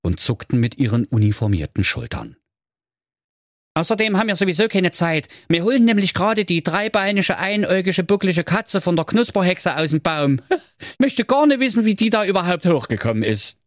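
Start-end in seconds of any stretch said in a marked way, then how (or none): 3.7-13.6 high, fast, offhand fireman voice with a laugh; busy fireman. A narrator's reading. Firemen on the phone telling Schoko Ramoni they're busy, high and fast. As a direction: rattle off excuses like a busy fireman.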